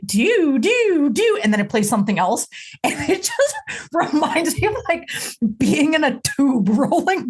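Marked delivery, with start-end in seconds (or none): 0.0-1.4 sung "Do, do, do" rising high; vocal imitation of a rising guitar slide; sliding guitar imitation. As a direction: hum the guitar slide upward. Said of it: "'cause it's like".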